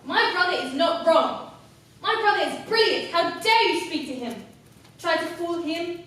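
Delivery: loud, high-pitched tirade of outrage; furious outburst. Acted. Acted furious outburst defending her brother.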